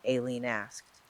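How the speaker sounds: ordinary delivery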